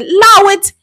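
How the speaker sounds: high, loud mock British slang; imitated British accent. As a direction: belt out a playful British-accented catchphrase. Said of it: "it's a British accent to say".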